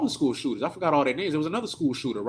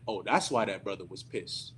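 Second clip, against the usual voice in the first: usual speaking voice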